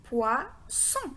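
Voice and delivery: "poisson" as pwa, pause, son; two separate beats on "poisson"; split into syllables. Shown as a demonstration. Models poisson split for spelling.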